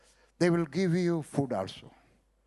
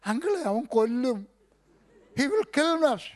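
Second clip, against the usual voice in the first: frightened plea; 9 semitones higher, 5 dB louder than usual. Retold or imitated. Boy hides, fearing the axe.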